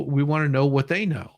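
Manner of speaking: normal talking voice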